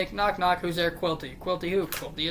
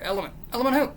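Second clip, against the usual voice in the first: usual speaking voice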